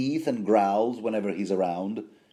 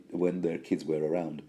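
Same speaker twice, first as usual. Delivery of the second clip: usual speaking voice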